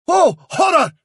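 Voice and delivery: high, loud gasp of horror from the gullible dog; horrified cry. A narrator's reading. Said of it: "cried Rowsby Woof".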